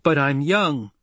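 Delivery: put-on indignant, voice lifting on "young"; angry protest. A narrator's reading. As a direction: protest with a stamp of the foot. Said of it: "shook her shoulders with anger".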